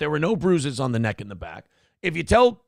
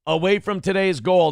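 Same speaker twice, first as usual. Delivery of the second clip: ordinary talk, nothing marked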